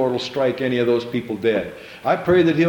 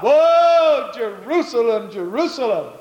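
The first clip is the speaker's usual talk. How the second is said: wailing lament; 9 semitones higher, slower than usual. Retold or imitated. Preacher voicing Jesus weeping over Jerusalem, wailing. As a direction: cry out over the city as if sobbing. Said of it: "begins to wail aloud and sob".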